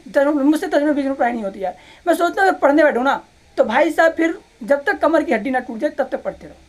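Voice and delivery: normal talking voice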